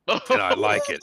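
high, loud outburst of delight; gushing fan. Acted. Young fan confesses to the author, high excited gushing. Eager fan gushes about books.

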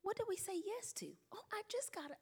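frantic hushed inner monologue; inner thoughts. Retold or imitated. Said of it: "I'm like".

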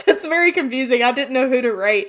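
loud, high, worked-up defense; flustered protest. Real, not acted. Cohost defending the mix-up over the role, loud and flustered. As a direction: protest loudly in amused confusion.

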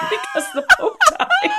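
very high, squealing through laughter; helpless laughter. Real, not acted. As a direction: barely get the words out for laughing. Cracks up at her punchline.

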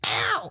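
high cartoon yelp; mock cry of pain. Luffy hit in the mouth by a blade, mock yelp.

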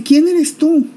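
pregunta alta y sorprendida; un padre desconcertado que no entiende; asombro. Acted. Isaac sorprendido ante Esaú. (padre atónito pregunta quién es)

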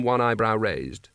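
plain reading voice

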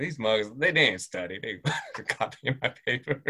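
amused mock complaint; laughing. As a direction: let laughter break into the retold grumble. Laughs retelling classmates cheating.

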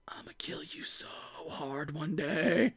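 whispered low threat; quiet whispered mock grudge; mock muttered threat. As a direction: breathe it through clenched teeth. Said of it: "He's just like".

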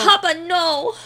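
high, loud silly voice; mock Papa voice. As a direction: pitch it up like a cartoon.

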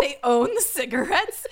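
laughing disbelief. Real, not acted. Incredulous laughing question.

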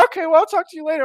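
high mock-sweet mom voice; chatty mom. Buddy's mom lets him go, chatty high voice. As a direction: sign off in an imitation mom chirp.

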